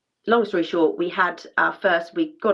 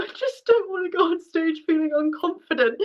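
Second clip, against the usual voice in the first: tearful; 11 semitones higher than usual. Real, not acted. Dancer reliving breaking down in front of the repetiteur, tearful. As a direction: voice breaking high with tears. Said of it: "I broke down in tears".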